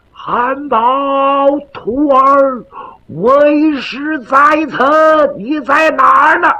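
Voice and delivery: 拉长嗓音高声唤徒儿; 隔着墙远远地喊人; 远处呼唤. Acted. 师傅在窗外拖长声音呼唤. (拖长声调呼唤徒弟)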